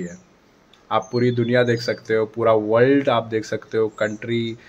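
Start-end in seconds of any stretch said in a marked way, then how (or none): none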